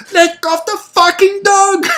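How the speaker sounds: high, loud, slow bellow; father still yelling from down the slope; retold furious shout. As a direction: bellow it with the fear turning to anger. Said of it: "still shouting at him".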